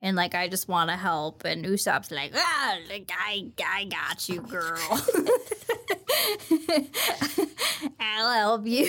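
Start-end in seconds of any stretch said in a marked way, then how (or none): 2.3-8.8 lightly voiced cocky Usopp, laughing through it; a hint of Usopp, cocky and amused; Usopp impression. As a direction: play it as a goofy reassuring pirate. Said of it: "That's exactly what Usopp sounds like".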